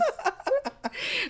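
real high-pitched burst of laughter. Real, not acted. Laughing at the story.

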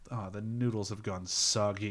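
imitating a let-down politician over lunch; disappointed. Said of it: "he's just so disappointed".

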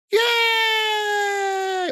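put-on kids cheering. Mouse children cheering the end of the play. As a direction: shout it like thrilled little kids.

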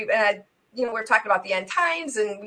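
normal talking voice

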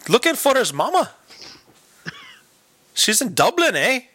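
high-pitched Irish storyteller character; Irish accent. Acted. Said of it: "into an Irish character".

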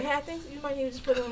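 soft, quick, careful suggestion; caring tone. Retold or imitated. Partner tactfully telling him to put deodorant on, soft caring tone. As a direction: slip the advice in very gently. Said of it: "in a caring tone".

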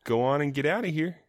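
comic Minnesotan-tinged Canadian impression; botched Canadian accent. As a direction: politely shoo a bear in a bad Canadian accent. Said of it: "more of a Minnesotan accent".

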